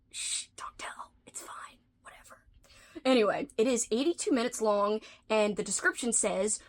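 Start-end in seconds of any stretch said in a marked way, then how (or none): 0.1-1.1 hushed secret whisper. Real, not acted. Sharing a secret about YouTube. 1.3-2.4 soft whispered reassurance. Real, not acted. Secret aside continues.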